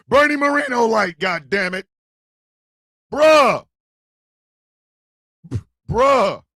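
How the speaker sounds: exasperated shout. Real, not acted. Exasperated outburst at Bernie.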